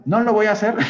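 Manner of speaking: high, loud teasing "No lo voy a hacer", pitch jumping up; playful refusal. Pretend. Teacher jokingly refusing to redo the lesson, high teasing. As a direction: refuse it with a cheeky grin before laughing. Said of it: "¿se acuerdan todo lo que les acabo de explicar?".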